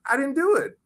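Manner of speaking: high mock whine; whiny protest. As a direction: plead like a cheated kid. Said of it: "I was like".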